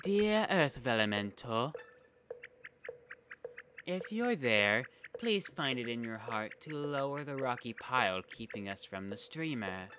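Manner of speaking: prayer. Acted. Character prays to spirit.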